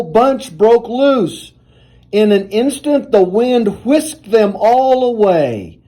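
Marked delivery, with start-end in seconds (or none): none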